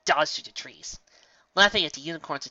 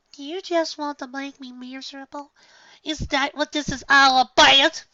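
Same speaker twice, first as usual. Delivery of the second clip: put-on hurt young filly; 14 semitones higher, 10 dB louder than usual. Filly accusing her sister.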